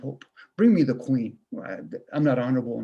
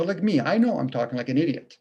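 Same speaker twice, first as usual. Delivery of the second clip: ordinary talk, nothing marked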